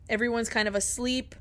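ordinary talk

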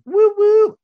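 high whooping cheer; silly clown. Real, not acted. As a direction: whoop it up for the guest. Hype-man intro for guest.

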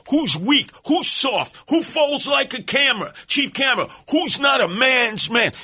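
high, heated challenge; mock taunting. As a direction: goad the room like a contest crowd. Taunting in handshake contest.

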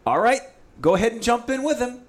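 quoting the guide's high call; 1 excited call. Retold or imitated. Guide calling out to jump in after the fish, a high excited call. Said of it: "So Ross goes".

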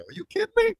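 high, delighted outburst, fast at the end; gleeful disbelief at a silly question; excited retort. Retold or imitated. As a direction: burst out with glee. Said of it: "I go".

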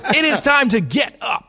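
high hammed-up wake-up shout; Greeny. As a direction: holler it like a morning radio host. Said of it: "I could be Greeny".

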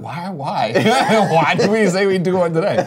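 mock whine broken by laughs; laughing complaint. Host mocking early wake-up, laughing and whining. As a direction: laugh through a tired why. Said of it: "you're like".

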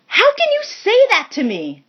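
high-pitched yell of disbelief. A narrator's reading. Callie hurt by Arizona's love claim, high yell. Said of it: "Callie yelled".

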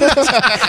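a short high burst of laughter. Real, not acted. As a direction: laugh out at the memory. Amused at the theater scene.

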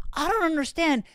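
high, loud exasperated imitation; imitating an indignant coffee loyalist; mock-serious Canadian. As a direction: rant it way up high, hammed-up bafflement. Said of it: "gets real serious".

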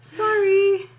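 high, loud sorry; yelled apology. A narrator's reading. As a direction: call out sorry from far away. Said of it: "she yelled from across".

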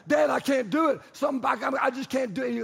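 high, shouted desperate protest; loud, frantic refusal; panicking kid. Retold or imitated. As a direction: imitate a child in a panic. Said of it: "he's freaking out, just having a panic attack".

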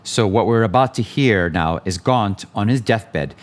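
ordinary delivery

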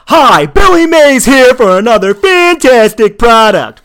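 loud, slow, high infomercial pitchman; Billy Mays impression. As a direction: belt it like a TV pitchman selling a gadget. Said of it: "I could do the Billy Mays from South Park".

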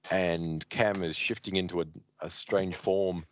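usual speaking voice

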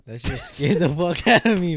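loud laugh through the words; laughing off a fright. Real, not acted. Laughing after a scare.

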